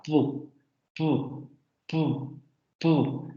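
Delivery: soft, clipped "pu" bursts; "pu" spoken as a short plosive burst; demoed p sound. Shown as a demonstration. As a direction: pop out "pu" with a puff of air. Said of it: "Si ustedes pronuncian la pu".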